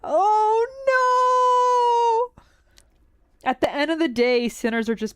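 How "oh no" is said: very high, drawn-out creature voice; Pibbles voice. Acted. Streamer imitating a pet-like big boy, in a high Pibbles voice. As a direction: coo it high and slow, stretching the last word. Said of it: "read that in the Pibbles voice".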